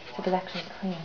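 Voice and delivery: ordinary talk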